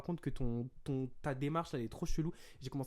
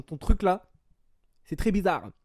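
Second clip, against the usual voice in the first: loud, higher retold outburst; annoyed French rant; 5 semitones higher, 10 dB louder, slower than usual. Telling off her friend too, loud irritated rebuke. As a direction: drive it home, fed up. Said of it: "je me suis encore une fois énervé".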